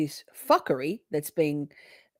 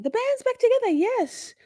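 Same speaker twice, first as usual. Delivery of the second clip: much higher, gleeful band's back; excited squeal; 3 dB louder than usual. Real, not acted. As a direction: squeal with joy. Excited exclaim.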